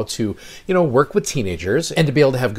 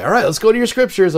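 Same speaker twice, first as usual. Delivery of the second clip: high, loud singsong classroom announcement; cheery teacher call; 11 semitones higher, 7 dB louder, faster than usual. Retold or imitated. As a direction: chirp it brightly to a bored class. Teacher calling class to scriptures.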